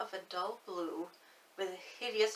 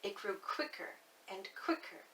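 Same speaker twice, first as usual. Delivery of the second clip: ordinary delivery, nothing marked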